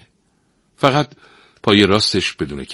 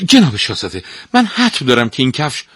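louder, higher, hurried and flustered; flustered stepmother; 7 semitones higher, 8 dB louder than usual. Acted. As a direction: rush the words in a panic. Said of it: "زن با دست پاچگی گفت".

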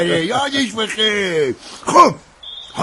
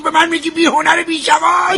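offended yelling in a quarrel; furious shout; 10 semitones higher than usual. Acted. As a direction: hurl the insult back. Quarrel, insulted by doctor.

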